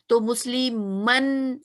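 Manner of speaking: मुस्लिमन drawn out slowly, ending on a clear नून; slow clear demo. Shown as a demonstration. Teacher shows students the नून sound, slow demo. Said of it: "इसमें भी हमें नून sound आ रही है".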